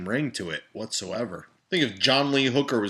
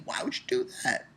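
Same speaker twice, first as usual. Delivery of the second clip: incredulous whisper; 14 semitones higher, 9 dB quieter than usual. Acted. Outraged at socks with flip-flops.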